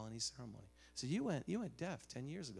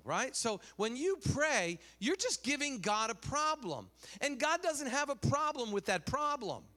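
high, loud preacher's emphasis; building, raised-pitch sermon delivery; forceful preaching; 9 semitones higher, 6 dB louder than usual. Real, not acted. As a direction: drive each phrase home to the congregation. Preaches his point forcefully.

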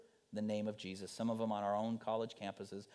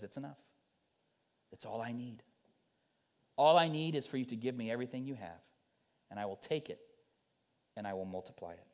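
gentle voice of Jesus; faster than usual. Acted. The Lord answers our empty hands.

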